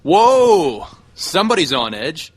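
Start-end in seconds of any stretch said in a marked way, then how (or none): 0.0-0.9 loud, high exclamation of surprise; startled whoa. Acted. Wrestler reacting to an edgy Randy, loudly startled. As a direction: cry out as if taken aback.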